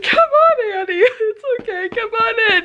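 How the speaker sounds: high, teary coaxing of the dog; sobbing "come on in" to the dog; crying to the dog. Retold or imitated. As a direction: plead gently through real tears. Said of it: "I'm like".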